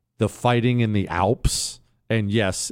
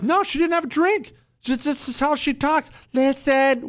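much higher, insistent wife voice; imitating Ab; 12 semitones higher than usual. Host quoting his wife defending the neighbor, high and insistent. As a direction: squeak it out like your wife correcting you. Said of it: "Ab will be like".

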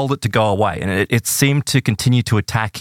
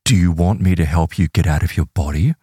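lightly voiced deep, low being; a hint of a deep baritone entity; 6 semitones lower than usual. Being offers Stephen help leaving his body, lightly voiced deep baritone. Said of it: "it has this deep baritone voice".

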